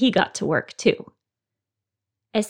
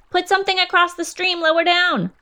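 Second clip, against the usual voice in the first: high, louder yelled instruction; yelled orders; 10 semitones higher, 4 dB louder than usual. A narrator's reading. Rabbit yelling to the others, loud high order. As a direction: yell it to the others downstream. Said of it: "Rabbit yelled".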